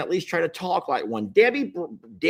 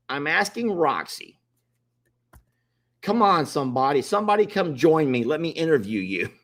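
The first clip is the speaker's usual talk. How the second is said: ordinary talk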